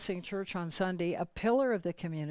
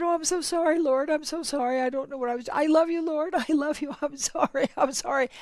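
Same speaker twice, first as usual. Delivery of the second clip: tearful apology; 7 semitones higher, 5 dB louder, faster than usual. Retold or imitated.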